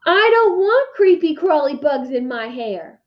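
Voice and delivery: put-on high, loud kid yell. A narrator's reading. Little boy yelling at his brother in a restaurant, loud high yell. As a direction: shriek it in disgust. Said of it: "Fudge yelled".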